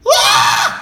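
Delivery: high, loud far-off wail; distant scream. Retold or imitated. Roller coaster rider hearing screaming from afar, loud wail. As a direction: let out a sudden scream from across the ride. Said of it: "I hear screaming".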